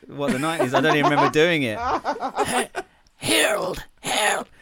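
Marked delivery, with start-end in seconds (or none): 1.8-4.5 very high, laughing "Harold" calls; Madge impression. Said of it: "get [name] to do his Madge impression".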